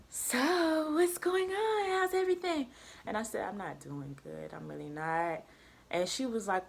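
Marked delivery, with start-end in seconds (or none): 0.1-2.7 high, drawn-out singsong check-in; lilting, high-voiced hello; imitated tutor greeting. 3.5-5.4 low, very soft and downcast; small, subdued reply; retold glum admission. Retells glum answer to tutor.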